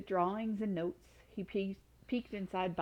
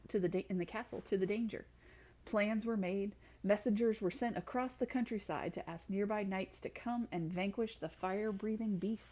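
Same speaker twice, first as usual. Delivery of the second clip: ordinary delivery, nothing marked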